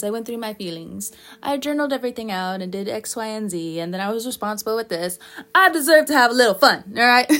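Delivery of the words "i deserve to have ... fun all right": defiant. Pretend. Voicing a Virgo's defence.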